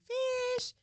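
high, loud call across the yard; sing-song shout for a lost dog. Retold or imitated. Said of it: "was calling out for him".

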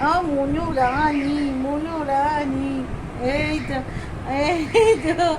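slow drawn-out sing-song name calling; fond calling. Real, not acted. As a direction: stretch the name to coax the dog. Calling the dog fondly.

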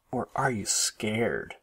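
slow, drawn-out teasing taunt; teasing question, stretched on the last word. A narrator's reading. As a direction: needle a little sister. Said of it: "teased Brother".

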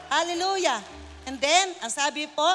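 loud ringing praise call; shouted hallelujah. Real, not acted. Preacher shouting praise to the congregation, loud. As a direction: cry it out to the crowd.

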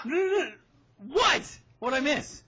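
high, loud startled outburst; real high-pitched disbelief; alarmed surprise. Real, not acted. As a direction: blurt it out, caught off guard. Caught out by unexpected email news.

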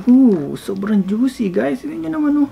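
excited. Real, not acted. Showing off juicy food.

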